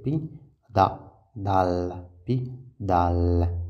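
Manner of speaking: "Pi, dal" broken into separate syllables; slow teacher's syllable split of "pi, dal". Shown as a demonstration. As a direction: sound out pi... dal with gaps between. Teacher breaks pédale into syllables.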